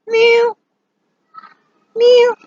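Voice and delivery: short, high, loud mews; kitten meow. Shown as a demonstration. Teacher showing what a kitten says, short high mewing. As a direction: mew briefly like a kitten. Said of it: "A kitten says".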